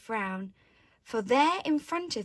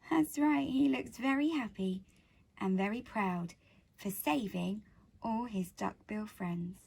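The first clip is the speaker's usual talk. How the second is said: usual speaking voice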